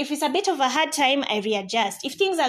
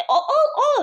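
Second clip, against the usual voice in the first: laughing; 7 dB louder than usual. Real, not acted. Laughs mid-list.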